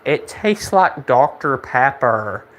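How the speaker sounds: slow, dim-witted mimicry of her; mock-dopey, stretched-out delivery; mocking imitation. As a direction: draw it out to make her sound clueless. Mocks her obvious answer about gum.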